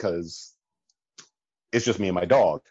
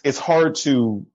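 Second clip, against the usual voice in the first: normal talking voice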